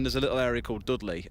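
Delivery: normal talking voice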